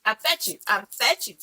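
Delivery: ordinary talk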